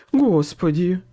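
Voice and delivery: strangled, horrified exclamation; choked voice. A narrator's reading. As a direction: choke it out in fear. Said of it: "произнёс Хват перехваченным голосом".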